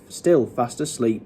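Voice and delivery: usual speaking voice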